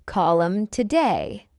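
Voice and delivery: slow, measured "Call 'em today"; slow model. Shown as a demonstration. Teacher models the phrase for learners first, slowly. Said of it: "fast English is not difficult".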